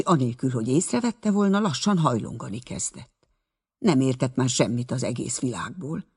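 plain reading voice

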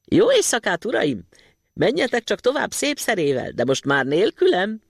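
teasing call to the thwarted men; mocking shout. A narrator's reading. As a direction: jeer at them from the mousehole. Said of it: "kiabálta kicsúfondárosan".

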